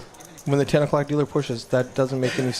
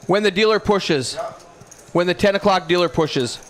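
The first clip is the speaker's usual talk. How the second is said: calling out; 6 semitones higher, 4 dB louder than usual. Real, not acted. Calling across room to Eddie.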